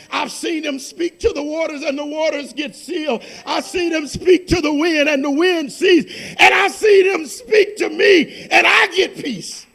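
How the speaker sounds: loud, fervent pulpit proclamation; preaching at full force. Acted. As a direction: thunder the scripture over the congregation. Preaching testimony climax.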